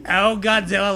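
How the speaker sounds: ordinary talk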